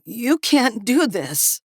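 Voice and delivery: high, slow, tearful plea; sobbing plea. Acted. Captive sobbing to his captors for his life. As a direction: beg through tears. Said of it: "Harvey sobbed".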